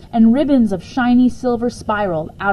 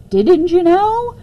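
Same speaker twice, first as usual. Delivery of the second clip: higher, louder, slow rising question; voice up at end; 10 semitones higher, 6 dB louder, slower than usual. Acted. Boy's sarcastic question, rising at the end. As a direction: let "know" climb high at the end. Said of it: "His voice went up at the end".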